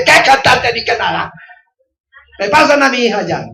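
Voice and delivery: angry father. Retold or imitated. Pastor on the phone demanding his daughter back, angry. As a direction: lay down the law on the phone. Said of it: "Así, me puse bravo".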